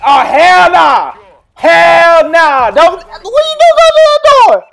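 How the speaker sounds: high, loud mock shout at the movie; shouting at screen. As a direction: yell it at the screen like a scared moviegoer. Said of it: "These women like".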